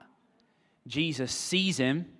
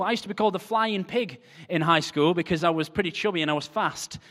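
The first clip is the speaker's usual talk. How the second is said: normal talking voice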